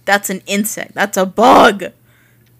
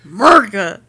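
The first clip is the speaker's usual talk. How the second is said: louder, amused call of the name; loud exclamation; 7 dB louder than usual. Real, not acted. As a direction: shout the name with delight. Repeating a strange name.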